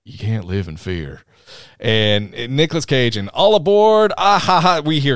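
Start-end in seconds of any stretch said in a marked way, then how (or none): 0.0-1.2 low Southern movie-hero voice; deep but not too deep, Southern. Retold or imitated. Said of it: "like, this deep Southern voice". 3.3-4.8 high, loud rock-frontman call; loud, crowing mock rock intro; song-intro shout imitation. Imitating Crazy Train's opening shout.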